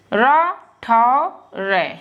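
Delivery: रा, ठा drawn out syllable by syllable; slow sounding out. Shown as a demonstration. Teacher breaks a name into syllables for pupils. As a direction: sound out each syllable slowly. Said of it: "sounding out syllables slowly".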